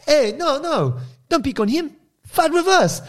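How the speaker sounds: high-pitched mock German backpacker; German accent. German backpackers defend old man from aggressor, German accent. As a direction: imitate an indignant German kid, very high. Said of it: "some German backpacker kids".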